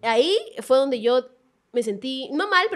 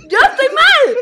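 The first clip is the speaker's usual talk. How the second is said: loud, much higher indignant protest; worked-up indignation; 10 semitones higher, 7 dB louder, slower than usual. Real, not acted. Told that she is wrong, indignant. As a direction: burst out in disbelief at the accusation. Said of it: "estoy sudando de la cólera".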